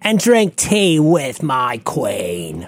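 very slow, drawn-out mock British accent; Tom Holland. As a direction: savour each word in hammy British. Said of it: "speaking in, with his British accent".

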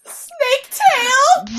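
high, slow disbelieving; incredulous. Real, not acted. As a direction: stretch it out in disbelief. Incredulous at the name.

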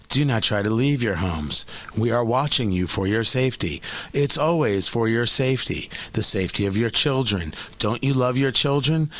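low, creepy authority voice; a sinister official, speaking low; disguised deep voice. Pretend.

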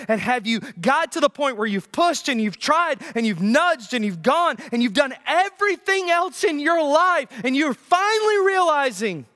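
rising, high-pitched, driving fervor; preaching climax. Acted. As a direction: build relentlessly to a peak.

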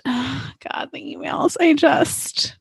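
overwhelmed with delight, words giving out; swooning gush. Real, not acted. Co-host swooning over the book's emails, breathless gush.

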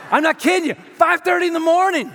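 loud, high shout to the congregation; preacher reliving the dropped egg, shouting high. Real, not acted. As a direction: yell it out, overwhelmed.

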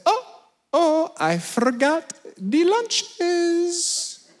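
very high, slow guide impression; Jenga. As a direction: draw out the sheepish confession in a high voice. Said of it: "He says".

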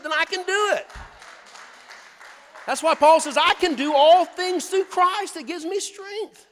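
rising to a loud, high proclamation; preaching climax. Real, not acted. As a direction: build it up and belt the scripture out.

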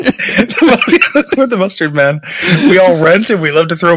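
slow, laughing mock announcement; laughing gang boast.

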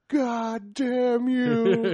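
slow, high, anguished movie despair; hammy stage actor. Retold or imitated. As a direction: drag out the despair like a ham actor. Acting out the film's ending.

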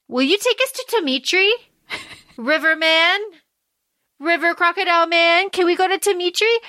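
acted high-voiced plea; mock hopeful asking. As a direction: ask high, as if calling on a stranger for a favor. Ali asking Sobek for a ride.

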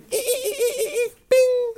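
very high squeaky sound effect; imitated ping.

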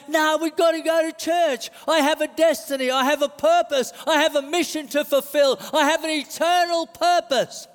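high, fervent declaration; insistent spirit guy. Acted. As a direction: declare your mission with rising conviction. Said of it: "spirit guy over here, he's saying".

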